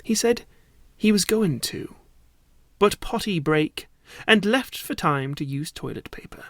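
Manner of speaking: plain reading voice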